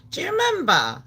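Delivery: normal talking voice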